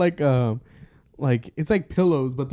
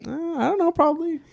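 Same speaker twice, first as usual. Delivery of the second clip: much higher, airy clueless answer; playing dumb; 14 semitones higher, faster than usual. Prank victim going along, mock clueless. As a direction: answer like you have no idea it's a joke.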